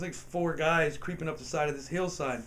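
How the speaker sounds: normal talking voice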